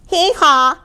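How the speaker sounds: high two-note hee-haw; donkey bray. A narrator's reading. Donkey waking the stable, high bray. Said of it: "brayed Donkey".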